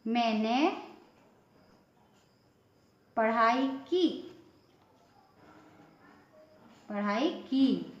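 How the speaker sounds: slow पढ़ाई की with की stretched long; long drawn kee. Shown as a demonstration. Teacher showing the long vowel to pupils, stretched kee. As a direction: drag out the ee of की. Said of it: "लंबा खींच के कहा है आवाज़ को".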